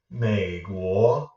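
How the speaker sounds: one continuous dip across "Mei Guo"; one big third tone. Shown as a demonstration. Teacher repeating the word for learners as one big third tone. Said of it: "make it one big third tone".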